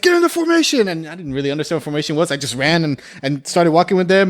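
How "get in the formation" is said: high, loud barked command; mock yelled order. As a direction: shout it like a drill instructor. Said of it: "everyone's like".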